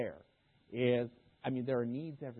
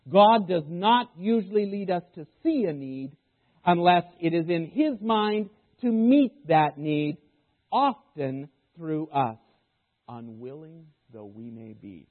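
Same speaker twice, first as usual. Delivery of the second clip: slow emphatic reread; 2 semitones higher, 12 dB louder, slower than usual. Shown as a demonstration. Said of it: "I'll say that again".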